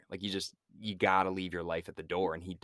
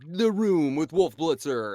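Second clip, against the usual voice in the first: slow, louder mock news-show intro; TV announcer impression; 6 semitones higher, 6 dB louder, slower than usual. Comparing the dispatch room to CNN, mock announcer voice. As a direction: draw out the show title like an announcer.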